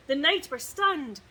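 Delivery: ordinary delivery, nothing marked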